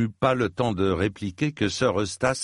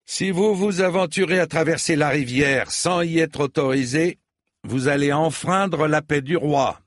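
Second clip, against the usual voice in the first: higher-pitched, firm warning; called warning; 7 semitones higher, 3 dB louder than usual. A narrator's reading. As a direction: warn them across the water. Knight calls a warning across river. (avertissement lancé avec force)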